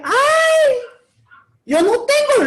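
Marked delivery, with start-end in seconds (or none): 0.0-0.7 high, yelped "Ay"; a jolted, surprised yelp; mock-startled high cry. Startled reluctant reaction.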